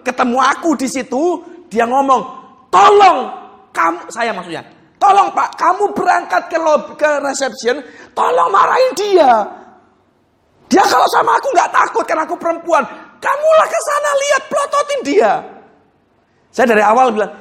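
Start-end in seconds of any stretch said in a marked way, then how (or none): 2.7-4.1 high, loud urgent plea; retold angry plea. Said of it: "dengan dia keadaan marah besar". 5.0-15.3 much higher, shouting at times; retold angry outburst. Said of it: "dengan dia keadaan marah besar".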